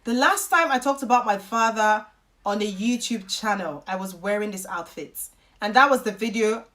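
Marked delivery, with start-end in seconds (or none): none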